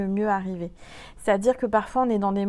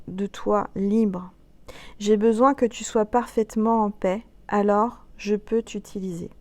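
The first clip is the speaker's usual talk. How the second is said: lecture de message inspiré; 4 dB louder than usual. Acted. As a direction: lecture solennelle, plus forte et posée. Lit un message canalisé. (voix de guidance spirituelle, appuyée)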